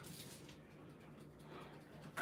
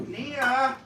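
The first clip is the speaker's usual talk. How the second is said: calling out; 11 semitones higher, 5 dB louder than usual. Real, not acted. Calling out to her daughter.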